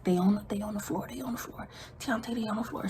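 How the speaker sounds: fast urgent whisper; hushed report at the window. Retold or imitated. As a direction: whisper the news quickly. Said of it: "we whispering".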